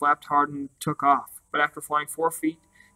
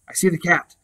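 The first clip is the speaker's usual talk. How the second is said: crying out; 3 semitones higher, 5 dB louder than usual. Acted. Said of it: "suddenly cried out".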